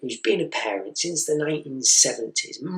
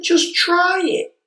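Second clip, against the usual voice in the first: much higher, slow, louder plea; pleading; 6 dB louder, slower than usual. Retold or imitated. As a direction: drag it out, begging. Begging child to eat.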